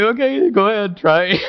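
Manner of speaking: put-on unbothered mom daring the child to try; calm mom. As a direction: mom calmly calling the bluff. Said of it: "mom can say".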